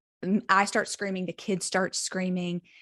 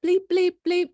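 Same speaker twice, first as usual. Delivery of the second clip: mock censor bleeps; 11 semitones higher, 6 dB louder than usual. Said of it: "I'm just like".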